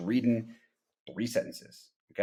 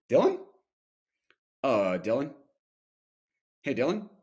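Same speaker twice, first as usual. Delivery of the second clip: varied takes on a name; 2 semitones higher than usual. Shown as a demonstration. Rep practicing a cold call, varying the name. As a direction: try the name several ways. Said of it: "try it a bunch of different ways".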